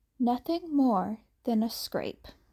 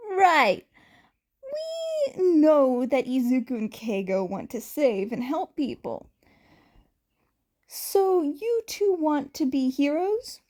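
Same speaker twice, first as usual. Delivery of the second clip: excited voice; 4 semitones higher than usual. Acted. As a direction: voice an eager, upbeat man. Yamada asking kids.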